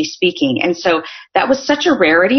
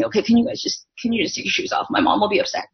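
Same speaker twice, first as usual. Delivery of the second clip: embarrassed hush; 3 semitones higher, 6 dB quieter than usual. Retold or imitated. Asking friends, embarrassed.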